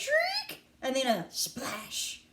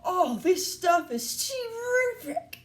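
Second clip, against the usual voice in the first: put-on high, slow, relishing boy; high-pitched savoring drawl; greedy boy voice; 8 semitones higher, slower than usual. A narrator's reading. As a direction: draw it out with gluttonous delight. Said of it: "said Augustus".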